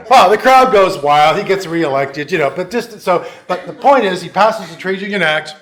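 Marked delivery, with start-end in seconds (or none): none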